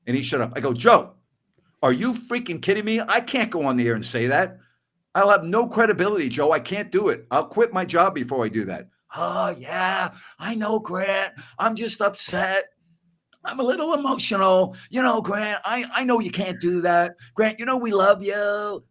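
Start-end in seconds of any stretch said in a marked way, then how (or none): 0.7-8.9 high-pitched, incredulous comeback; exasperated acted objection to the boss; indignant protest. Arguing back at team owner. 9.2-18.8 high-pitched, drawn-out, apologetic whine; placating, upset caller in a put-on high voice; Joe Maloof. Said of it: "That's the best Maloof impression".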